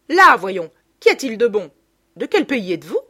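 loud coaxing demand; insistent mother. A narrator's reading. Mother loudly coaxing the waiter for advice. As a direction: press him, loud and chummy.

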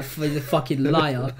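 slow, laughing fucking liar; mock outrage, laughing; laughing accusation. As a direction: tease through laughter. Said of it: "She's like".

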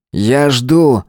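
mildly menacing impatience; threatening. Acted. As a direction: press them with a restrained threat. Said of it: "с угрозой сказал он".

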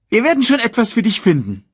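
high, quick cartoon meerkat; chirpy little sidekick voice. Timon speaks.

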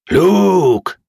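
high, loud drawn-out call. A narrator's reading. New voices call to Luke as they approach, loud hail. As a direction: hail a friend from afar. Newly arrived friends call out.

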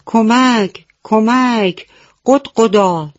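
high, loud cry for help as mother hen; calling for help. Acted. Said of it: "مامان مرغ فریاد زد". (با صدای بلند و زیر فریاد کمک)

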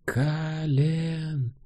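distant cry, high and quiet; calling out. A narrator's reading. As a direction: call his name from far away. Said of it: "Снова раздался крик Мирин, на этот раз громче". (далёкий крик)

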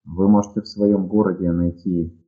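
normal talking voice

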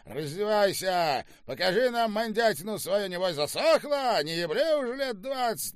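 high, jeering officer's yell; bullying shout. A narrator's reading. General humiliates the old prisoner, jeering shout. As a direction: order and taunt, climbing in pitch. General bullies and jeers at woman.

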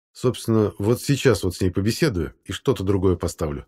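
usual speaking voice

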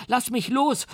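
put-on very high, rising child's cry; boy's scream. A narrator's reading. The boy begs the monster to let go, shrill and rising. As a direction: wail it like a panicking boy. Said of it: "schrie er".

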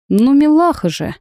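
put-on high cooing baby talk. A narrator's reading. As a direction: gush over a cute pet, high. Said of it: "Продолжаю сюсюкать".

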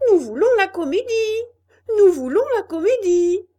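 crié très fort et très haut; cris du public. A narrator's reading. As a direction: scande pour réclamer la pièce. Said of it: "se mit à crier". (le public impatient crie à pleine voix pour la comédie)

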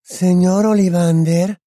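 put-on high, slow, airy girl's voice; Luna. A narrator's reading. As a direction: call politely, stretching the name. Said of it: "le oyó decir Harry a Luna".

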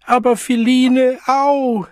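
put-on very high, loud child's protest; distressed cry. A narrator's reading. Child being struck by his mother, crying out in distress. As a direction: wail in pain and alarm.

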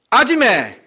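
loud, high, short Gyeongsang call; Gyeongsang dialect. Acted. Diner complaining in a comedy sketch, Gyeongsang dialect. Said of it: "경상도에서는".

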